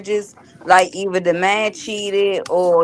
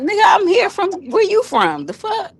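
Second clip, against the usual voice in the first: high-pitched mock-offended comeback; indignant retort; 11 semitones higher than usual. Retelling a comeback to DMV people, high and indignant. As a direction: throw the question back, pitched way up.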